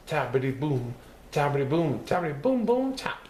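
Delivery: rhythmic drum sounds, rising each time; drum rhythm chant. Acted. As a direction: tap it out like a drummer. Imitating drumming again.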